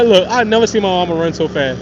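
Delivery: normal talking voice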